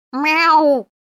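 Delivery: very high yowled cat meow. A narrator's reading. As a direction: yowl like a slightly surprised cat. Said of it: "проорали с той стороны куда громче и слегка удивлённо". (громкое удивлённое мяуканье)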